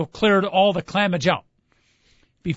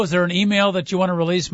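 usual speaking voice

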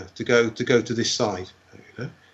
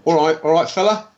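high, cheeky greeting; 6 semitones higher than usual. Retold or imitated. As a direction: imitate a cocky nudge-and-hello, pitch climbing. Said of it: "in cheeky Ray style".

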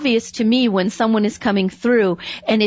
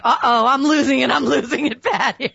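laughing self-mockery; 3 semitones higher than usual. Own panicked thought, retold.

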